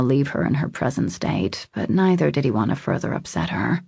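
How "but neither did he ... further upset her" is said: ordinary delivery, nothing marked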